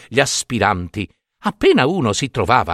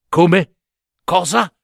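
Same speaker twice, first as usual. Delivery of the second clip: puzzled, higher-pitched, rising at the end; bewildered question; 5 semitones higher, slower than usual. Acted. Second brother thrown by the princess's answer, bewildered. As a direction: ask it blankly, caught off guard. Bewildered suitor asks, scribes repeat.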